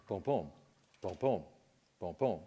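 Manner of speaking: soft, even booms like a normal pulse; quiet, evenly spaced heartbeat booms; steady heartbeat sounds. Shown as a demonstration. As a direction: tap out a calm, regular beat with your voice. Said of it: "That's a normal beat".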